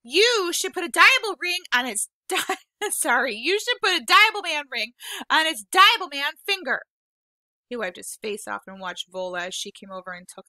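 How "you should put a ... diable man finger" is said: hysterical laughing. Acted. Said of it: "kind of hysterical now".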